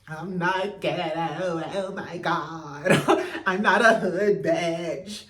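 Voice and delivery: slow, drawn-out mock voice of Stormy, laughing through it. Vlogger mocking Stormy's reunion words, slow and laughing. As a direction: mimic her protesting, slowly, with a laugh. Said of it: "Talking about".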